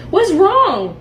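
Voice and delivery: ordinary talk, nothing marked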